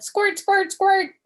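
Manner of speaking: high loud squeal; excited squeal. Acted. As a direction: shout it like a game. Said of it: "I said".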